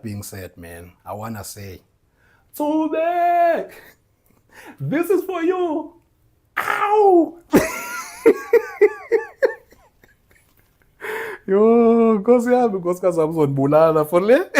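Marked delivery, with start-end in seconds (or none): 2.5-5.9 hoog, luid, langzaam uitgeroepen; mock dedication. Mimics LeBron's dedication. 6.6-9.7 hoge kreet en lach; whoop and laugh. Real, not acted. 11.5-14.5 hoog, luid en snel, lachend; laughing joke. Real, not acted. Joking to wife in Xhosa.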